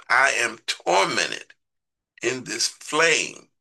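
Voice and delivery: slow anguished quote. Retold or imitated. Preacher quotes rich man's cry.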